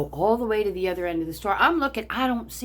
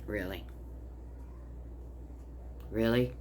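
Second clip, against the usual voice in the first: flat disbelief; 10 semitones lower, 13 dB quieter than usual. Real, not acted. Incredulous at the threat.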